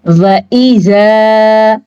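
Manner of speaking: slow, drawn-out tajweed chanting; Quranic recitation. Shown as a demonstration. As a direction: recite slowly, stretching each vowel. Recites the verse word. (धीमी तिलावत)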